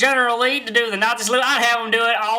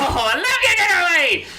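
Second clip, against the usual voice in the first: gushing over dog; 9 semitones higher than usual. Acted.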